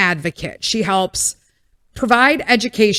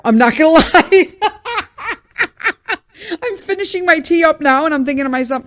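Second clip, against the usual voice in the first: higher, louder, laughing through the words; 8 semitones higher, 5 dB louder, faster than usual. Real, not acted. Admitting to viewers she wants another drink, laughing. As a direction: giggle at her own craving.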